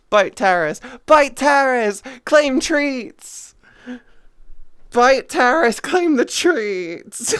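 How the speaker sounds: loud mock war chant; rally the troops, half joking; chanting orders at chat; playful battle cry.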